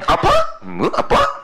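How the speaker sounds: sudden high startled "Apa?"; mock-surprised husband. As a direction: act shocked, then drop back down. Husband reacts to wife's request.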